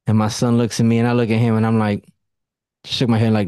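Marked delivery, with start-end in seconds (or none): none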